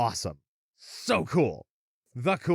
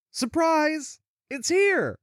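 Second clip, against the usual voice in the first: much higher, gleeful reveal; spring a surprise in a high voice; excited announcement; 3 dB louder, slower than usual. Real, not acted. Host reveals the awaited show to listeners, excited.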